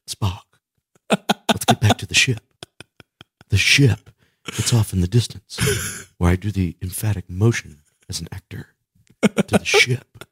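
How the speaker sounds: lightly done Kirk, halting dramatic pauses, stretching the last words; Captain Kirk impression. Teasing his co-host by imitating Captain Kirk, lightly hammed-up. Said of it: "talk like Captain Kirk".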